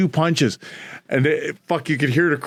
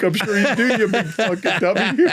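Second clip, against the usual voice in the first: higher, laughing taunt; mocking laugh; 6 semitones higher than usual. Taunting the beaten husband, higher and laughing. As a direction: sneer through a laugh. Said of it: "I'm like".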